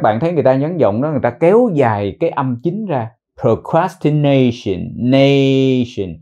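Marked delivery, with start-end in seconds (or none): 3.4-6.2 procrastination with the na syllable held long; stretched stress on -na-. Shown as a demonstration. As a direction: lean on nation and stretch it. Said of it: "cái chữ nay nó kéo dài ra". (procrastination, kéo dài âm nay)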